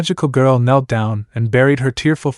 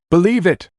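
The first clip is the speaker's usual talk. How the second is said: higher, announcing boom; 7 semitones higher than usual. Acted. Millie Sparkles announces herself from above, booming. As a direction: proclaim it like a grand entrance. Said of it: "said a booming voice".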